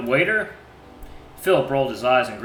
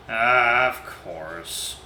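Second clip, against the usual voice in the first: ordinary talk, nothing marked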